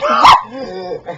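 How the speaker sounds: a mad, gloating laugh; manic laugh. Acted. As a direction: burst out laughing like a madman. Madman narrator gloats.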